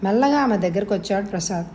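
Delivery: usual speaking voice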